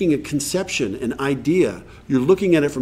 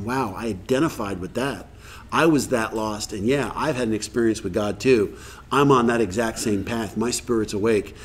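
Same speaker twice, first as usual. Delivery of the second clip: lower, softer, moved murmur; awed inner voice; 5 semitones lower, 4 dB quieter than usual. Retold or imitated. Moved listener at a meeting, quiet and awed. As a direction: let the story touch your soul. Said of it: "you're going".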